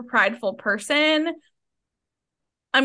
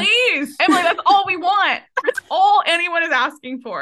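high and louder, begging with a laugh in it; eager plea; 6 semitones higher, 5 dB louder than usual. Real, not acted. Host begging Emily to have a fling: high eager plea. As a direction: push her on, all excitement. Urging friend to flirt abroad.

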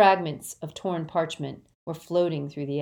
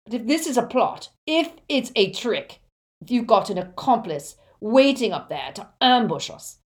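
loud, raised, rough warning; bullying villain laying down a warning; menacing threat; 6 semitones higher, 7 dB louder than usual. A narrator's reading. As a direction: threaten forcefully, building the conditions. Selwyn threatens the captive father.

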